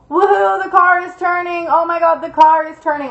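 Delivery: high-pitched, playful fake thrill; mock mild excitement. Storyteller shows what drifting wasn't, mock giddy voice. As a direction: pretend a little turn is a fun ride. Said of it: "I don't just mean like".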